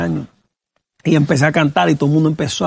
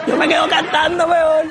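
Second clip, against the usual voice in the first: high-pitched words through laughter; real laugh riding over the line; 11 semitones higher than usual. Real, not acted. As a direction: squeeze the words out while laughing. Laughing at his own retold reaction.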